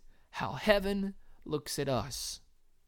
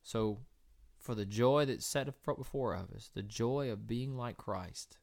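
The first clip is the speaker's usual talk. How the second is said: soft closing exhortation; 7 semitones lower, 9 dB quieter than usual. Real, not acted.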